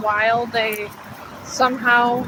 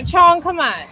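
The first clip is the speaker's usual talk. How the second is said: louder, higher call to the dog; 6 semitones higher, 4 dB louder than usual. Real, not acted. Owner calling her dawdling dog Chong, loud and higher. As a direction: call him on up the hill.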